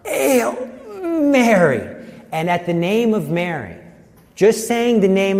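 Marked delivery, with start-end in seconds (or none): 0.1-1.8 strained, higher-pitched release of words; imitated struggle to speak, then breaking free; forcing words out. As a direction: act out pushing words through a choked throat. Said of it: "able to get the words out".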